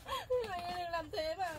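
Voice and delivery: high, crying plea. Acted. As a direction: cry out to stop him. Tenant cries out to landlord.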